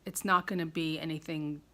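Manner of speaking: ordinary delivery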